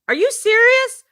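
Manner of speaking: a stunned boss squeaks it out; shocked disbelief. Retold or imitated. Boss on the phone learning both staff quit, high shocked squeak.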